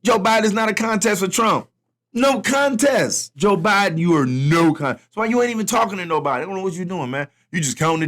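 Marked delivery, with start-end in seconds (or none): 2.1-3.3 slow emphatic dismissal; drawn-out shout. Acted. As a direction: stretch it out and shout it. Mocking Biden's chances.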